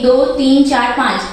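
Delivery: ordinary talk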